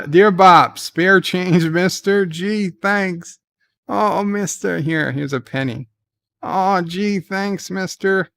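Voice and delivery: high, whiny beggar voice quoting a movie; pleading beggar. Retold or imitated. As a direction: beg for spare change, high and pitiful. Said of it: "That's from U H F".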